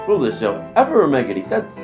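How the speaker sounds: normal talking voice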